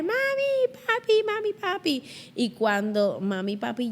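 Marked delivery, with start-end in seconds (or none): 0.0-2.0 very high calling out; frightened child screams for her parents at night; screaming for parents. Retold or imitated. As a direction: scream for mom and dad in terror. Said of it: "Ahí pegué a gritar".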